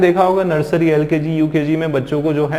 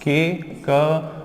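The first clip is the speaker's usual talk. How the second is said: के, क drawn out slowly; trying to spell cat, slow; sounded out slowly; much slower than usual. Shown as a demonstration. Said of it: "कैट कैसे लिखेंगे बताओ?".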